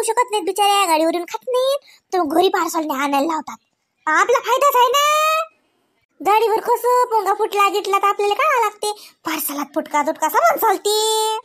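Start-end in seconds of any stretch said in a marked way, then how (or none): 4.1-11.4 pitched up, cheeky business boast; boasting about parcel orders with a cheeky grin; sly boast. Real, not acted. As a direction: let them in on a crafty trick.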